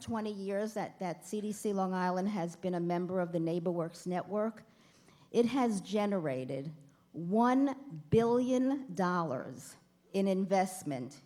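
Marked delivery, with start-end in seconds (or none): 5.3-11.2 slow, deliberate delivery with pauses. Real, not acted. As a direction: spell out the big figure slowly, phrase by phrase. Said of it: "I'm gonna say this very slowly".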